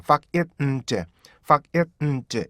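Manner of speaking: find broken into 팍-잇-은-드; staccato breakdown of find; four separate beats. Shown as a demonstration. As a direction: chop each syllable of fuck it, 은 이제. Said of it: "이렇게요".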